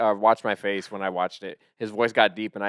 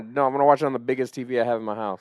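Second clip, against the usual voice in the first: ordinary talk, nothing marked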